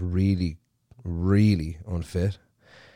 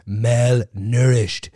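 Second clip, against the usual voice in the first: Malnourished drawn out slowly and loud, falling; spelled-out emphasis; 4 semitones higher, 6 dB louder, much slower than usual. Pretend. Mocking the viewer, loud slow Malnourished. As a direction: hammer out Malnourished syllable by syllable. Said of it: "do you want me to spell it for you?".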